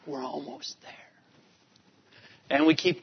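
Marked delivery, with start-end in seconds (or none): 0.1-0.9 whispered, voiceless and soft; hushed whisper. Retold or imitated. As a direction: breathe it like a secret from the back seat. Kids spotting the Arch in car.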